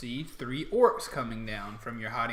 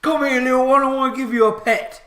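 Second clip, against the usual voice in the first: high, very loud orc cooing at a dog; delighted orc; 13 semitones higher, 18 dB louder than usual. Acted. Orc guard calling to the dog, delighted. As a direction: call the doggy over at full volume. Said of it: "the one orc says".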